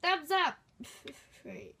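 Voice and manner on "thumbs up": excited. Acted. Excited call.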